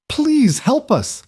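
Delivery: high, slow, croaking plea. A narrator's reading. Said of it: "Her voice came out as a croak".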